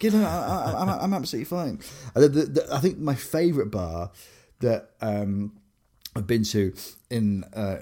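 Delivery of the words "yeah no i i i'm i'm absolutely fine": embarrassed laugh through the words; giggling, faster, covering embarrassment. Retold or imitated. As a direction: laugh while insisting you're fine.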